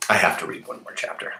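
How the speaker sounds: fast hushed whisper to the listeners; whispered aside. Real, not acted. Reader confides to her listeners in a fast whisper. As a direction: sneak it out, quick and hushed.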